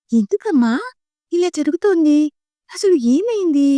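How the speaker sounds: a hint of a puzzled little girl asking; child's question. A narrator's reading. Child asks mother about war.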